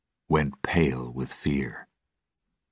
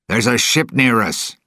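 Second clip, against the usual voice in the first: shouted warning; 8 semitones higher, 5 dB louder than usual. Acted. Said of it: "he shouted".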